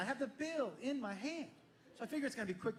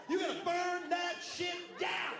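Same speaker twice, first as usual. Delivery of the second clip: much higher, slow comic yell; mock outraged shout; 8 semitones higher, slower than usual. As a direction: bellow it like an outraged punchline.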